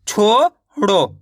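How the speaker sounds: छोड़ो split into chho, pause, ro; slow छोड़ो, syllable by syllable; sounded out in parts. Shown as a demonstration. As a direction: sound out छोड़ो with a gap before the last syllable. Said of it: "इस तरीके से direct".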